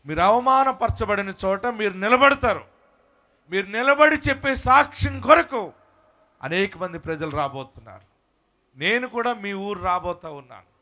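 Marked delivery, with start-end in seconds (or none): none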